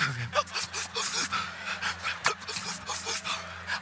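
imitated long, high, breathy stuttering sobs. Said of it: "One of those cries".

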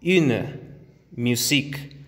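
"une, musique" with rounded U; French U words. Shown as a demonstration. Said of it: "aquí tenemos".

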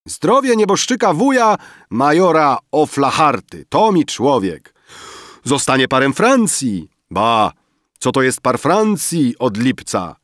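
loud, high-pitched banquet toast; rozbawione okrzyki. A narrator's reading. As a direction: shout a merry toast to the room. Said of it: "Okrzyki rozbawionego zgromadzenia". (wesołe, głośne okrzyki przy stole)

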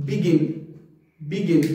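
बिगिन with two short i sounds; correct pronunciation drill. Shown as a demonstration. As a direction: repeat बिगिन as 'bi-GIN'. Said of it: "आई वाली ही stress देनी". (बिगिन का सही उच्चारण, छोटी 'इ')

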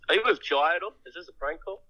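ordinary delivery

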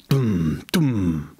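low, heavy drum-like boom; booming drumbeat. A narrator's reading. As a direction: let each word thud like a distant drum. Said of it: "dröhnten die Trommelschläge".

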